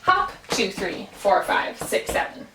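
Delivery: even beats pressed, two and four leaned on; syncopated count. Shown as a demonstration. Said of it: "add a hop where I'm saying it".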